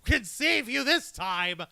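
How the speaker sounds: over-the-top taunting pronouncement; gloating declaration. Acted. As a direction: draw out each word in triumph. Mock villain gloating at FaZe.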